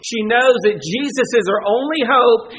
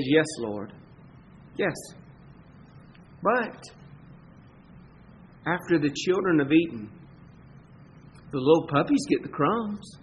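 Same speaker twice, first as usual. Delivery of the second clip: much lower and softer, meek; humble plea; 3 semitones lower, 8 dB quieter than usual. Pretend. The woman answering Jesus, low and humble. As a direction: murmur it humbly, head bowed.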